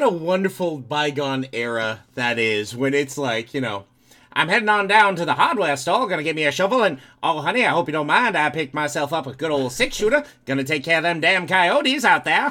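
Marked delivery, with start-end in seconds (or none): 4.3-12.5 hammed-up Texas accent. Imitating an old-timey Texan buying a six-shooter, hammed-up accent. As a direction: play a 1930s radio Texan heading to the hardware store. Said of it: "You sound like a nineteen thirties New Yorker trying to do a Texas accent".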